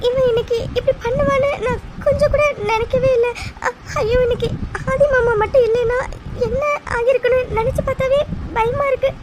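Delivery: high, tearful sobbing voice; crying. Acted. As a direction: cry through the words in fear. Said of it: "அழுதுட்டே இருக்கா". (அழுதுகொண்டே பயத்துடன்)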